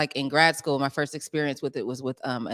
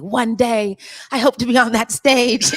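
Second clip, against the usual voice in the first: put-on dreamy young self; 8 semitones higher, 10 dB louder than usual. Retelling childhood dream.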